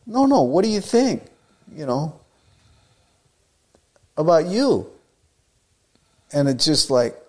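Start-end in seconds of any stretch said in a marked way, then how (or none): 0.1-4.9 high, insistent, urging him to judge himself; pressing question. Retold or imitated. Colonel's family pressing the young surfer, high and eager. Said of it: "they go".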